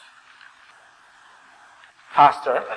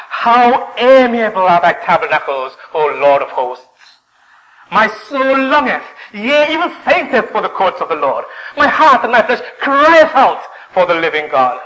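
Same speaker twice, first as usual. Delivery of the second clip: impassioned longing; 7 semitones higher, 6 dB louder than usual. Acted. Worshipper arriving in the Lord's house, impassioned. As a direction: cry out with yearning for God. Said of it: "he sighs, and he says".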